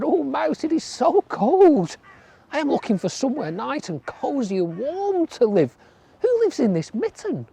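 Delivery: a chilly frog begging for shelter; high cold frog voice. A narrator's reading. Frog asks the mouse for a warm home: high pleading voice. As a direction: pitch it high and plead, shivering.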